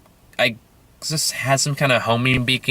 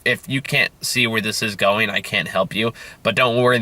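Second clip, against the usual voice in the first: normal talking voice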